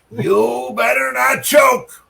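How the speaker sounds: slow, high mocking taunt; mock taunting lady. Heckling lady from last match taunting him, slow high mock shout. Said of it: "I can see this lady telling me".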